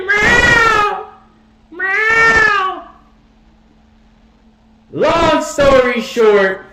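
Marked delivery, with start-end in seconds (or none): 0.0-2.9 high, drawn-out cat meow. Retold or imitated. Speaker voicing Ghost joining in, high cat meow. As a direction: imitate a cat with long, high cries. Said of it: "He said".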